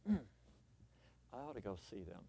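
low, very soft musing; hushed, low voice to oneself; inner thought. Acted. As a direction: murmur it as a private thought. Acts out a private thought.

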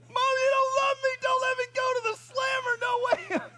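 put-on very high, loud desperate child voice; panicked kid pleading. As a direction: beg like a terrified little boy. Boy pleading against police.

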